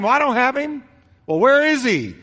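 escalating into alarm; panicked retort. Acted. Parents' panicked blame quarrel.

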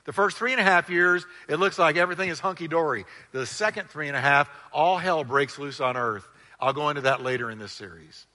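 normal talking voice

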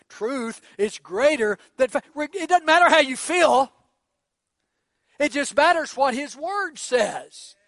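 loud, high preaching climax. Acted. Preacher driving the main point to the congregation, loud.

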